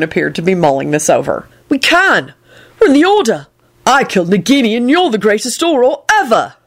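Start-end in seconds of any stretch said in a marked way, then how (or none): 1.7-6.5 high elated cry. A narrator's reading. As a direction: burst out with joy. Neville voiced.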